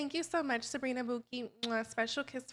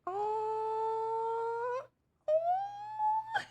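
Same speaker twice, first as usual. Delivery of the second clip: high squeaky mock cry, much higher; mock sob; 10 semitones higher than usual. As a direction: wail it out in a hammed-up falsetto. Contestant overwhelmed by kiss.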